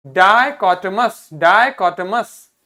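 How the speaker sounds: डाइकोटमस loud, slow, syllable by syllable; pronunciation drill. Shown as a demonstration. Teacher asks class to read it, loud, slow डाइकोटमस. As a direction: project each syllable of डाइकोटमस. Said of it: "इसको पढ़िए".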